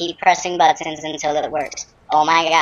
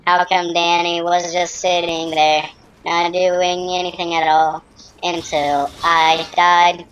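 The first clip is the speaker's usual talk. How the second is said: ordinary talk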